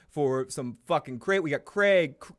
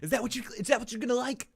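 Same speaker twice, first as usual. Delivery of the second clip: high mocking voice; 8 semitones higher, much faster than usual. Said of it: "I can't even do a good Doctor Phil".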